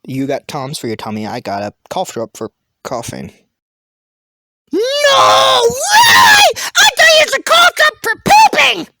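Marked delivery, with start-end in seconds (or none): 4.7-8.9 very high, shouted disbelief; mock amazement. Teasing the cough drop about its job, shouted mock amazement. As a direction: squeal it in huge fake astonishment.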